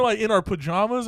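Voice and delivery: ordinary talk, nothing marked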